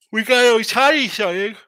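loud, hyped call-out; excited announcement. Real, not acted. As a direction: announce it like big news.

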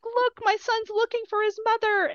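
high-pitched gushing; re-enacted motherly excitement; retold delighted mother. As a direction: gush proudly, voice way up high. Retold proud mother spotting son.

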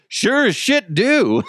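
high, slow laughing announcer voice; high, slow mocking commentator. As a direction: ham up the play-by-play guy. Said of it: "the other guy was just like".

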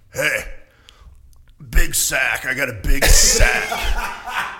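slow, drawn-out jokey "big sack"; player imitation. Player teasing the announcer about his name, slow and jokey. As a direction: lay it on slowly like a proud player. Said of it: "he goes".

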